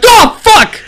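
high, loud panicked yell; startled yell. Retold or imitated. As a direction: yell it as the car swerves. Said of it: "I was like".

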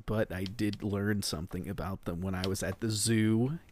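ordinary talk, nothing marked

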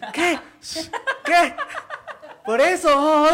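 high, loud challenge with laughter; mock tough kid. Kid squaring up to the bogeyman, loud and high. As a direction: bark it like a kid picking a fight.

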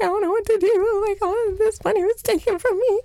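very high, wailing voice; mock crying to dad. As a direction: whimper it like a distraught kid. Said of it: "being like".

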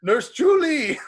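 put-on high, loud call with an accent; Lars impression. Friend quotes Lars calling for the nurse, high and loud.

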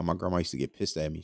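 normal talking voice

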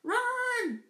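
high, urgent yell. Acted. Harry to his friends as they flee, yelled. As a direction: yell it out as a command. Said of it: "yelled Harry".

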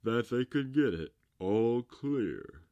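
pompous walrus. A narrator's reading. Walrus asks the carpenter about sweeping sand, pompous character voice. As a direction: project it as a walrus posing a grand question.

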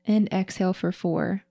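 slow, paced breathwork counting; slow breath counting. Shown as a demonstration. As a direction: count the breaths out slowly and evenly. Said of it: "we'll go ahead and inhale through the nose".

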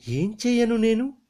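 a very high, loud helpless cry. Acted. Latha weeping helplessly as her lover bleeds, crying high. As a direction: wail it high in despair. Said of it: "ఏడ్చింది లత".

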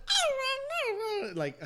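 squeaky mock whine; whiny little voice. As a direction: mimic her little put-on voice. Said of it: "I hated her voice".

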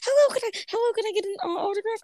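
put-on high, eager little kid voice; high child fan voice; squeaky fan voice. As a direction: pitch way up like a small excited fan. Fan voice asking for autograph.

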